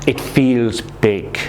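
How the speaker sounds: slow, weighted "it feels big"; emphasize it feels big. Shown as a demonstration. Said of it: "You want to emphasize".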